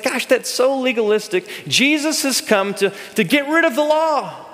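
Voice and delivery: high, airy dismissive tone; lightly mocking careless believer; mock naive. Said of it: "we might say things like".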